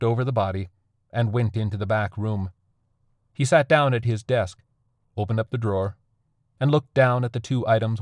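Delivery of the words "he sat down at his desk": ordinary delivery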